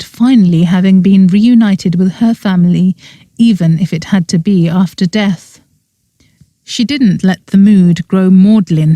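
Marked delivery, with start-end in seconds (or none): none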